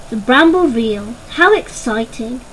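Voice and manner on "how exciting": plain reading voice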